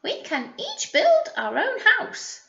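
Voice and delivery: high, bright-voiced little pig with an idea; pig character voice. Teacher reading to the class voices a pig, high and eager. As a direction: pipe it up high like an eager piglet.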